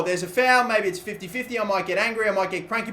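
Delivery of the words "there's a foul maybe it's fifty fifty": ordinary delivery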